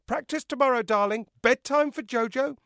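high, loud singsong summons; mother calling. Acted. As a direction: call it through the door to your darling. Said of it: "a muffled voice that I took to be Echo's".

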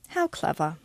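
put-on dry, condescending English accent; faint praise with an English barb. A narrator's reading. English teacher answering Miranda's craft idea, barbed English accent. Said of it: "uses her accent to barb everything she says".

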